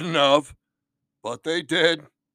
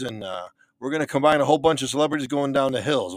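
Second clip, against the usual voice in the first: ordinary talk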